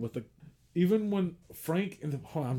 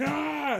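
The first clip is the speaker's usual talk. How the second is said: short high yelp of fright; hammed-up startled scream; mock scream; 12 semitones higher than usual. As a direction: yelp like he's seen an eyeball. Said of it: "He's like".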